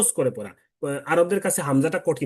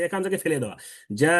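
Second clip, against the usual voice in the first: normal talking voice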